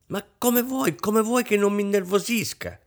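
very high, plaintive wail; tearful cry. A narrator's reading. Overwrought wife protesting to her husband, tearful high cry. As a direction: protest as if on the verge of tears. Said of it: "gridò Rosine con voce lacrimosa".

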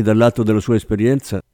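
usual speaking voice

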